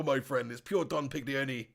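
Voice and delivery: ordinary talk, nothing marked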